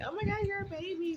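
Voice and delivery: high, gushing mock coo at a baby; gushing friends. Friends hearing she's twenty-seven, high mock gush. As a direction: imitate people fussing over how young she is. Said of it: "people are so like".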